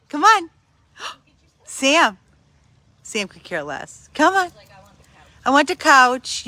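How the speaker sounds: normal talking voice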